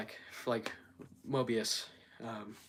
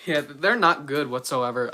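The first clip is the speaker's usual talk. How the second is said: ordinary delivery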